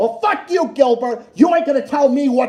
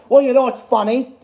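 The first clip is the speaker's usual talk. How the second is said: usual speaking voice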